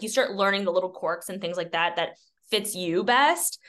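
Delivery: usual speaking voice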